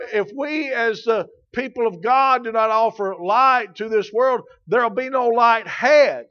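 loud, rising pulpit preaching; full-force sermon delivery; fiery preaching. Acted. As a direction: drive it home from the pulpit.

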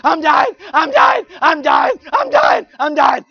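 screaming, high and loud; dying words. Retold or imitated. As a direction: wail like a fussing baby. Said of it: "scream and act like I'm dying".

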